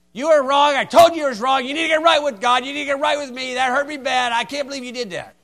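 loud, high scolding rant; browbeating. Retold or imitated. Scolding a wronged friend into apologizing, loud and high. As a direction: berate them until they give in. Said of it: "They browbeat them".

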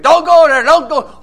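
high, loud, urgent shout; yelling warning. Acted. Man warning drivers before a broken bridge, yelling and screaming. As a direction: yell to stop someone in danger. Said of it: "he's yelling and screaming".

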